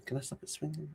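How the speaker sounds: ordinary talk, nothing marked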